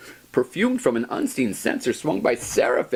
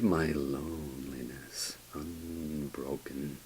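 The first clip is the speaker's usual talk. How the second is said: breathy hushed command; whisper; 6 semitones lower, 12 dB quieter than usual. Acted. Narrator dismissing the raven in a whisper. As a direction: breathe it out unvoiced.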